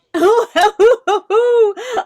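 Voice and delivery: bright high laugh; happy laugh. Acted. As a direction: laugh off the teasing. Laughing at teasing.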